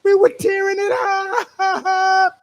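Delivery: high, drawn-out excited holler; excited boast. Retold or imitated. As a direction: holler it high, stretching the last word. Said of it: "I said".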